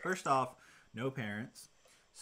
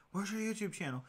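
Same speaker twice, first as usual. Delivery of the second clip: higher-pitched mock kid question, not shouted; imitated pestering kids; 8 semitones higher than usual. Kids ask him every time, imitated higher-pitched pestering. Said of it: "they would always come up and ask".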